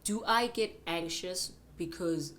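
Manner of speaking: ordinary delivery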